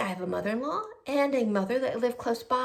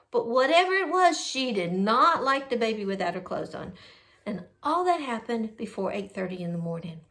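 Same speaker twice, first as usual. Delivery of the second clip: ordinary delivery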